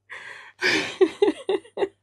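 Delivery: long, loud, high laugh; amused laugh. Real, not acted. Artist laughing at her own contagious yawn. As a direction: laugh at yourself.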